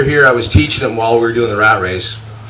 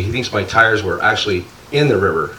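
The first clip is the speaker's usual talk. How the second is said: ordinary talk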